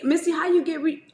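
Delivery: high, loud mock of a nagging viewer; mock viewer question. Mocking followers who keep asking her secret, high and loud. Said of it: "So don't be asking me".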